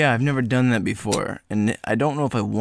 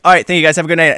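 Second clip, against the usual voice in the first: louder, brisk comic goodbye; stand-up sign-off; 3 semitones higher, 6 dB louder, much faster than usual. Pretend. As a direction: wave off the crowd cheerily. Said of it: "And then I'm like".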